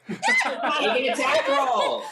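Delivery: loud, high call through laughter; laughing shout. Real, not acted. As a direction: burst out laughing as you order it. Game master laughs at player's line.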